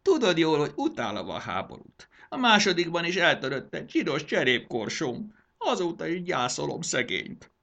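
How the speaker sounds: high-pitched petulant grievance; hammed-up sulky complaint; whining. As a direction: moan self-pityingly over a trifle. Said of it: "nyafogott Mondán".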